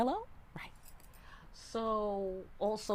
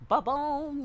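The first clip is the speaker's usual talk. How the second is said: high, loud "ba-boom"; mimed impact boom; 11 semitones higher, 5 dB louder than usual. Acted. Tarot reader acting out the querent's sudden self-realization, mimed impact boom. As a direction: punch out a boom like a sudden blow.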